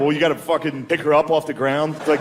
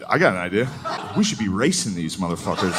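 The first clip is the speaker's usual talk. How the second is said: scheming executive; 6 semitones lower, 3 dB quieter than usual. Retold or imitated. Board member pitching Special Olympics.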